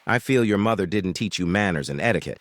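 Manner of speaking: ordinary delivery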